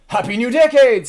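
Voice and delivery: high, loud festive call; cheery greeting. Acted. Streamer reading chat's new decade wish, cheery. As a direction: ring it out like a party greeting.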